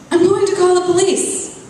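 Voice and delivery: scared woman threatens to call the police, high and loud; frightened threat. Acted. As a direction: threaten them with shaky resolve.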